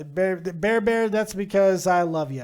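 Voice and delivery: ordinary talk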